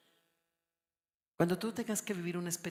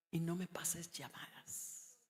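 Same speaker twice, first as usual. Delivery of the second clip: retold whisper; 5 dB quieter than usual. Retold hushed moment.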